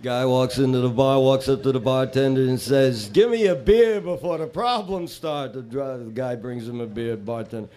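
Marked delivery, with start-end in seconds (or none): 3.1-5.5 higher-pitched mock demand; demanding bar customer. Customer orders a beer from the bartender, higher-pitched demand. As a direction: order it as a hammed-up, high-voiced customer.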